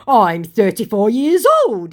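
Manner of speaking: high, loud, petulant insistence; hammed-up wounded dignity; mock protesting scammer. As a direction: insist on it like an offended child.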